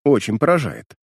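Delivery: usual speaking voice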